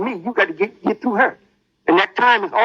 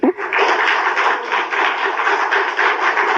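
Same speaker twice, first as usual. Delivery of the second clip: breathy laugh. Real, not acted. Laughing at enemy's punishment.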